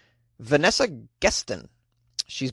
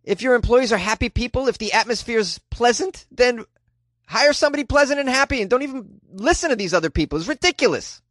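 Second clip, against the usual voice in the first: heated, high-pitched rant; getting fired up about it; worked up; 8 semitones higher than usual. Real, not acted. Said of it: "Whew, I gotta calm down".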